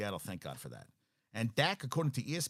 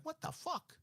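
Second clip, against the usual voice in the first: squeaky high disbelieving cry, softer; shocked mom; 8 dB quieter than usual. Retold or imitated. Host mimics a stunned mom after an interception, high shriek. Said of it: "She's like".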